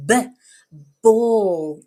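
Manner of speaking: "B" as short buh, then loud full "ball"; first-sound demo. Shown as a demonstration. Teacher lets child hear first sound, "B" short, "ball" loud. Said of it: "Can you hear that first sound?".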